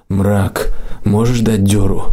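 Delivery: usual speaking voice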